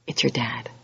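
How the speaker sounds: hushed reveal, a breathless whisper; dramatic whisper. Acted. The judge on the bench turns out to be your dad, whispered reveal. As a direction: let the twist drop in a whisper.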